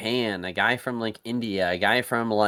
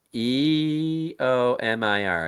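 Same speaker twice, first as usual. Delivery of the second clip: slowly spelled out; 3 semitones higher, much slower than usual. Shown as a demonstration.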